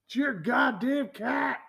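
high, slow exasperated yelling; indignant shout. Real, not acted. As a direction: throw it back at her, fed up. Indignant retort about litter box.

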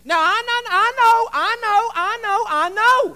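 high, loud, repeated concession; heading off objections. Real, not acted. Preacher answering those who disagree about feet, high and insistent. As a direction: pitch it right up and pile up the repeats.

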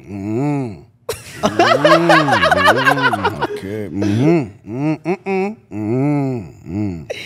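mock humming with laughs breaking through; dread humming along. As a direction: hum lazily like a dread. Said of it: "it's just a dread going".

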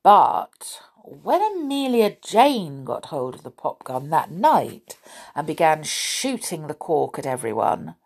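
ordinary delivery

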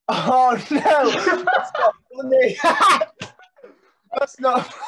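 high, loud laughing fit; cracking up while protesting; helpless laughter. Real, not acted. Friend laughing at the joke.

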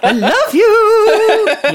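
very high, drawn-out, loud coo; mock lovey-dovey. Podcaster joking about rowing on Valentine's, mock adoring squeal. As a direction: stretch it out in a hammed-up squeal. Mimicking loving words after a row.

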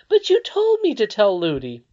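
put-on a little girl objecting; young Irene. A narrator's reading.

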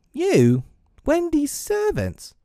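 slow, very high scandalized questions; incredulous. A narrator's reading. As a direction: echo it appalled, rising to a squeak. John aghast at being servants.

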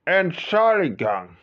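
high and loud, slowly stretched out; loud, drawn out. Acted. Asking the hijacker loudly to be excused.

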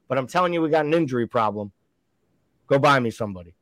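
usual speaking voice

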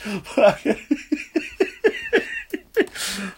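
long, high cackle at one's own rant; high wheezing laugh. Real, not acted. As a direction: crack up helplessly, high and wheezy. Cracks up after acted rant.

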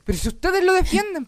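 high, indignant mock yell; re-enacted yelled comeback; retold shouting. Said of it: "le dije así como gritando".

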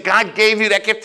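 high, loud indignant preaching; acted impassioned tirade. Preacher quoting his pastor on misplaced applause, impassioned. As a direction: build the offended pastor's case.

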